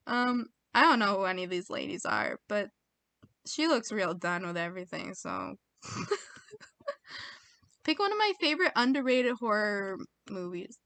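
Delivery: ordinary talk, nothing marked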